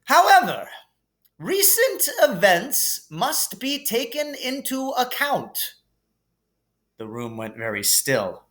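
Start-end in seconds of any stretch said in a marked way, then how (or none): 0.0-5.8 higher, grand headmaster with a twist; Dumbledore voice. Acted. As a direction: build suspense like a wise old wizard. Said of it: "said Dumbledore".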